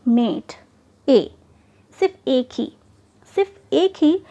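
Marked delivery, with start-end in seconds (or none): 0.1-1.3 "मेट" as one syllable, then its lone vowel "ए"; demonstrated pronunciation. Shown as a demonstration. Teacher showing how the word is pronounced, demonstrating "मेट" and its vowel "ए". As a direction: say "मेट", then isolate the single sound "ए". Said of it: "हम इसे pronounce कैसे करते हैं".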